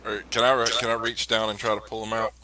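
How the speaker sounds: ordinary talk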